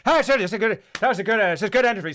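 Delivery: loud, high, mumbled mock Irish accent; Colin Farrell. Imitating actor in interview, thick Irish accent. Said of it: "Colin Farrell's like".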